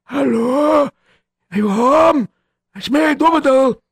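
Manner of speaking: put-on high thin old man calling at the door; a hint of a frail old man. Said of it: "a very old man who came knocking".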